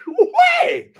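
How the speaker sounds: very high, loud whoop; hammed-up falsetto cry of amazement; amazed yell. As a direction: re-enact a fan's astonished shout. Re-enacts amazed reaction to save.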